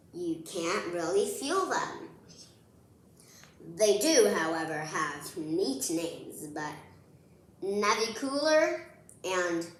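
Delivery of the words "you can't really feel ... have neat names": ordinary delivery, nothing marked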